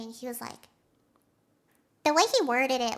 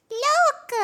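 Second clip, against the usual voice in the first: high, loud excited squeal; excited call; 6 semitones higher, 5 dB louder than usual. Real, not acted. Streamer spotting cute fan art, loud excited squeal. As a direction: point it out with a thrill.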